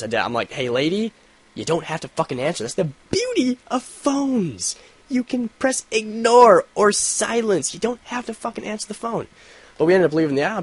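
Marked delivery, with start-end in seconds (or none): 0.5-9.3 condescending lecture. Retold or imitated. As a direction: explain it like she's a child. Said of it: "I wanted to say this".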